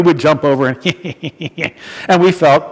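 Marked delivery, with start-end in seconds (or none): 0.8-1.7 soft, imitated sneaky snicker; mischievous giggle. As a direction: mimic kids giggling as they get away. Imitating kids escaping over fence.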